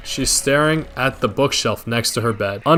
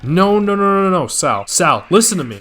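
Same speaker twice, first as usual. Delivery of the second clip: panicking; 6 semitones higher, 3 dB louder than usual. Retold or imitated. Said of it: "Penguin starts panicking".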